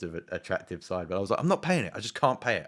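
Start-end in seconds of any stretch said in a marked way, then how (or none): 1.4-2.7 high, loud refusal that settles down; insist, then wind down; loud high refusal easing off; mock indignant refusal. Refusing the fine.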